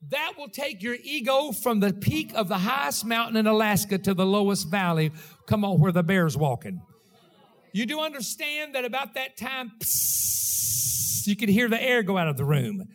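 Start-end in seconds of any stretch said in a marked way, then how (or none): 0.1-6.8 loud preacher building to the climax; booming sermon delivery; full-force preaching. Acted. 9.8-11.3 unvoiced psst of air escaping; air hissing out. Air out of room.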